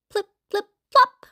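high, popping plip-plop; dropping treats. A narrator's reading. Treats drop into bags, high popping sounds.

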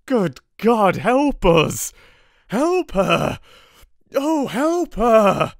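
high, loud anguished cry; desperate plea. A narrator's reading. Husband crying to God to save his wife, anguished. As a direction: beg heaven in desperation. Harker pleads for help.

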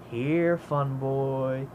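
very slow, stretched imitation; drawn-out taunt. The crow greets Fun Boy, imitated slow taunt. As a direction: draw each word out teasingly.

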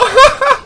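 high squealing laugh; helpless high cackle; laughter. Real, not acted. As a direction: burst out laughing loudly, very high. Laughs at flung player.

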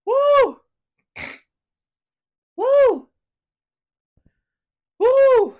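loud, high "woo" to shake off tears; sharp bursts of "woo" between pauses; emotional woo. Real, not acted. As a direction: let out big high whoops to regain composure. Shaking off tears.

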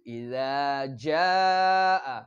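ইযা জাআ drawn out slowly with a long falling জাআ; slow Quranic chant of ইযা জাআ; tajweed recitation. Shown as a demonstration. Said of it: "আপনারা এটা বলবেন".

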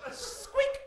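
very high tiny squeak; shrill mock mouse squeak. Mouse noise imitation.

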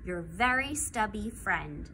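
usual speaking voice

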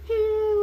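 high, choked little sob; short sobbing whimper; tearful whimper. Acted. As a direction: let a sob slip out. Old woman breaks into crying.

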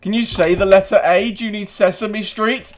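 high, loud mocking jeer; taunting. Real, not acted. Teasing the American player, high loud mocking taunt. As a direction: rub it in.

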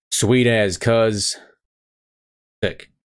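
slow, Kiwi accent on sweet as cuz; broad kiwi accent. Shown as a demonstration. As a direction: drawl it out like a New Zealander. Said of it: "the New Zealand English course".

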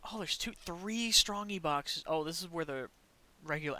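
ordinary talk, nothing marked

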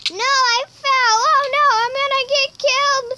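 high, loud panicked cry; freaking out. Real, not acted. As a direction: panic as you fall. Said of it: "I'm freaking out, guys".

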